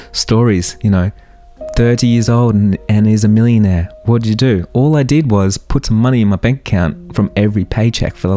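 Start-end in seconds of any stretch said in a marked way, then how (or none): none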